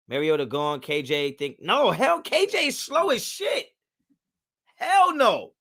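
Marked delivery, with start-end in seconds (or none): 1.6-5.5 high-pitched incredulous outburst; outraged disbelief. Real, not acted. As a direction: cry out in indignant refusal, voice jumping up. Outraged rejection of a player.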